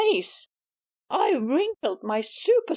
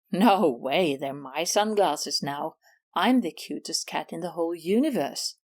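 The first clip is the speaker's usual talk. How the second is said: much lower, gloating cat voice; smug rival cat; 10 semitones lower than usual. Acted. Miss Maggie refusing to give Susie the sunglasses, lower and gloating. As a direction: sneer it out, keeping the prize.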